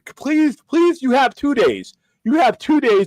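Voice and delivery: high, slow drawn-out pleading; pleading please. Real, not acted. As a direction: beg them, stretching each please. Pleading mockingly with critics.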